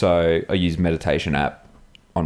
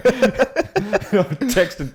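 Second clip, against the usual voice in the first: laughing; 13 semitones higher than usual. Real, not acted. Laughing through the story.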